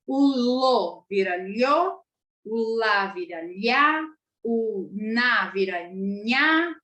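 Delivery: slow "lo/lho, lá/lhá, na/nhá", each syllable stretched; syllable contrast. Shown as a demonstration. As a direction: demonstrate plain "lo, lá, na" turning palatal "lho, lhá, nhá". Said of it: "ele já modifica o som da sílaba".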